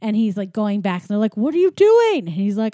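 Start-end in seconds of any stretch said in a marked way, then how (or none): 1.4-2.2 high, loud retold protest; mock incredulous. Rats asking why he's going back, mock incredulous cry. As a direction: call it out in mock disbelief.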